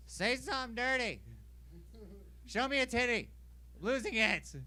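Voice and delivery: high, strained calling-out; mock desperate plea. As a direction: plead urgently in a put-on voice. Acting out desperate calls while waiting.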